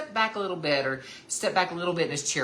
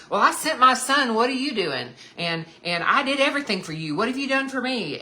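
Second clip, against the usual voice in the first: high, loud, accusing rant; mock indignant God; 8 semitones higher, 6 dB louder, faster than usual. Pretend God scolding his ungrateful children, indignant. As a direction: play a resentful God listing grievances. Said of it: "he just doesn't come at us like that".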